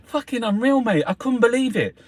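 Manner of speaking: high, loud, thrilled outburst; real excitement. Real, not acted. Raving to viewers about a night at the theatre, excited. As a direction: burst out in amazed delight.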